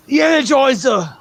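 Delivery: high punchy TV ad announcer; Energizer ad guy. Retold or imitated. Recalling the buzz-cut Energizer commercial guy, high announcer voice. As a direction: sell it high like an old battery commercial. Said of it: "that guy with the buzz cut ... Energizer commercials".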